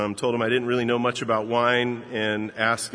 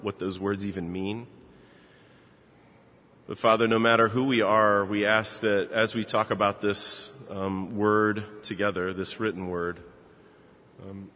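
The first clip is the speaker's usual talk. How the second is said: prayer; 3 semitones lower than usual. Acted. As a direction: pray gently over the congregation. Pastor prays for congregation.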